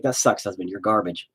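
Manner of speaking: normal talking voice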